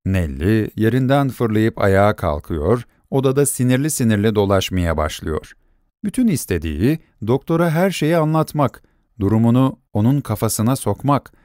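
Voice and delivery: usual speaking voice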